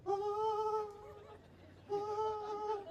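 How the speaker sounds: tiny high frightened squeaks; scared squeal. Pretend. Woman hiding behind tree, high squealing. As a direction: squeak like a cornered damsel.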